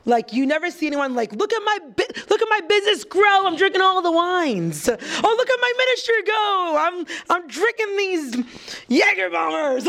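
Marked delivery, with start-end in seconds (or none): 1.3-9.8 high-pitched hammed-up bragging; gleeful mock brag; mock boasting. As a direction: parody someone showing off, voice way up. Mocks imagined boast about drinking.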